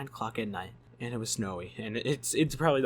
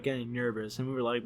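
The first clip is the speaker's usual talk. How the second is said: ordinary talk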